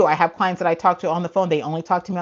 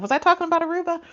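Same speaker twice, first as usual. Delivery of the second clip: baffled question; 12 semitones higher, faster than usual. Retold or imitated. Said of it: "I was like".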